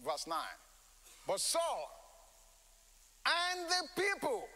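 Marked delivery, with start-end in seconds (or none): 1.3-4.4 the people lifted high and slow; stress on people. Shown as a demonstration. Preacher stressing the people to the congregation. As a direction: lean hard on people, drawn out. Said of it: "don't forget the emphasis".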